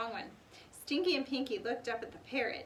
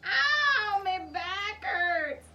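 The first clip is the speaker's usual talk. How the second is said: higher, slow moaning complaint; whiny complaint; 9 semitones higher, 8 dB louder, much slower than usual. A narrator's reading. Stinky complains about his back, slow whiny cry. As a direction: drawl the complaint out. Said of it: "Stinky cried".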